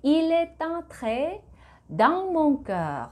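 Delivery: "Il est entré" slow, with the t carried into "entré"; liaison demo. Shown as a demonstration. Teacher demonstrates liaison for Korean learners, slow. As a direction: link "est" to "entré", very slowly. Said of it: "이렇게 발음이 됐어요".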